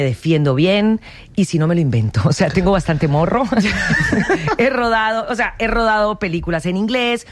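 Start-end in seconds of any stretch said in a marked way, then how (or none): 3.5-4.5 real laugh, pitched up; self-amused laugh. Real, not acted. Guest admitting she has bastante morro, self-amused laugh. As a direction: laugh at your own cheek, higher.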